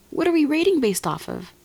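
loud, high indignant question; outraged. Real, not acted. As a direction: demand an answer, incredulous.

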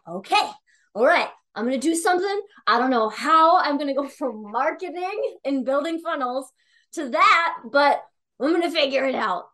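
high, loud, determined declaration; fired-up resolve. Retold or imitated. Deciding to quit marketing, high excited resolve. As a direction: pump yourself up to leap.

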